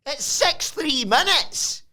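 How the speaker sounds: high, loud put-on cry of no; mock outraged cry. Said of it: "Noam says".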